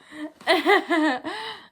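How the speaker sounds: high, put-on chuckle; acted character laugh. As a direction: laugh lightly in character as the interviewee. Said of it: "That was her laugh".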